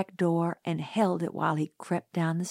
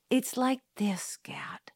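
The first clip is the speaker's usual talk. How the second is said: muttered; 3 semitones higher, 4 dB quieter than usual. A narrator's reading. Jim explaining himself to his sister, quiet mutter. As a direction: mutter it under your breath. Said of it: "he muttered".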